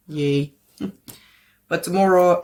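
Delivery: normal talking voice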